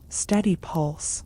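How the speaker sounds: ordinary delivery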